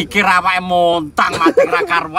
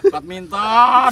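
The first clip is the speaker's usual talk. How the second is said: slow drawn out; 8 semitones higher, 5 dB louder, much slower than usual. Real, not acted. Drawn-out teasing answer.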